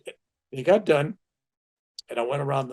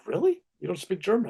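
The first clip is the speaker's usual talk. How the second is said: surprised; 6 semitones higher than usual. Retold or imitated. Host shocked he speaks no German.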